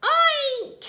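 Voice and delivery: high, loud oink; cats faking oink. A narrator's reading. Cats tricking the thieves, high oinking. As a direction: squeal like a pig. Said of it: "said the cats".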